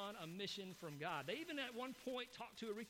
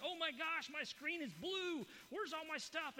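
higher, louder fake panic over a dead screen; mock panic scream; 8 semitones higher, 4 dB louder, slower than usual. Pranking a phone scammer, screams in mock panic. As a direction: ham up a shriek of computer panic. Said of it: "I just scream like".

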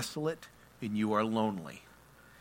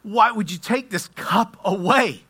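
loud, high anguished plea; raised-voice pleading prayer; crying out to God; 11 semitones higher, 11 dB louder than usual. Acted. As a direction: cry out to God in full-voiced desperation. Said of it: "He actually cries out".

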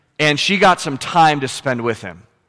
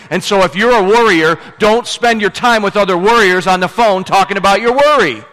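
raised, louder preacher's voice driving each point home; full-force preaching; 8 semitones higher, 5 dB louder than usual. Acted. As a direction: preach it hard to the congregation.

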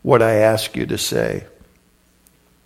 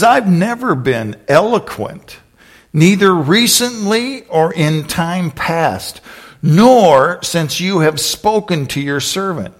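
higher, slow drawn-out excuse; pleading excuse; 5 semitones higher, slower than usual. Acted. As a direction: plead it slowly, pitched up. Moses pleading inability.

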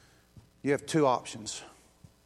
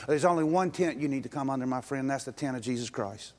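normal talking voice

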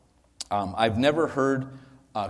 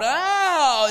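very high, loud wail; freaked-out demon scream; 6 dB louder than usual. Acted. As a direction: shriek in sudden panic. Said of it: "and he freaks out".

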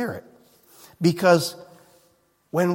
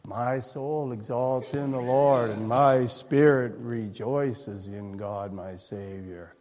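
low, slow, lifeless monotone; joyless droning Mary; 9 semitones lower, 7 dB quieter, slower than usual. Pretend. Preacher parodying Mary reciting praise, low slow monotone. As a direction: drone it like a dull chore. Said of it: "Mary responds in kind and goes".

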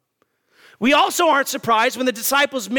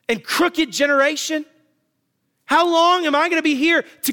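exasperated Jesus; 5 semitones higher than usual. Retold or imitated. Preacher voicing Jesus rebuking his failed disciples, exasperated. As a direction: scold like a parent fed up with the mess. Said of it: "Do you see his tone?".

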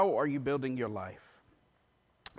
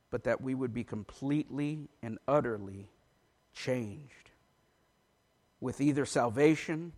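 lower, softer, halting public prayer; earnest prayer; 5 semitones lower, 6 dB quieter than usual. Real, not acted. Preacher asking the Lord to use him, hushed earnest prayer. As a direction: pray quietly, pausing between phrases.

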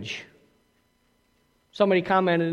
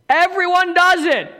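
mock excuse; 8 semitones higher, 6 dB louder than usual. Mocking the common excuse.

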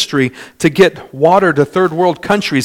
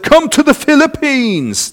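much higher, his cheerful, inviting way of talking; Pastor John's voice; 11 semitones higher than usual. Retold or imitated. Preacher imitating his late friend Pastor John's invitation, much higher and inviting. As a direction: invite like the visiting pastor would. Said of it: "That's how he talked".

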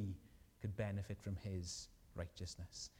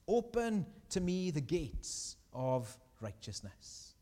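slow, higher kingly proclamation; king's demand; 5 semitones higher, 4 dB louder, slower than usual. Acted. Victorious king at the gates, demanding entry. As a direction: call out slowly like a king at the gates. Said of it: "And he asks".

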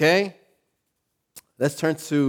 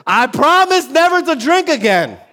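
high, loud desperate plea; hungover vow; 12 semitones higher, 7 dB louder than usual. Retold or imitated. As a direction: beg over the toilet bowl. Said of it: "hugging that toilet".

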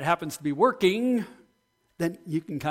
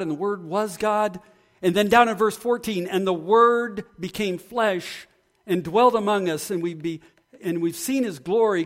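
raised, ringing preaching of the verses; fervent scripture reading; 4 semitones higher, 4 dB louder than usual. Acted. A pastor proclaiming John one to his church in a louder, raised preaching voice. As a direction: proclaim the Word to the congregation.

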